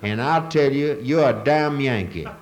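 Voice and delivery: slow, loud Southern local bragging; slow Southern drawl. Retold or imitated. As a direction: stretch it out like a proud Georgia local. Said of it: "I talked to another person who said".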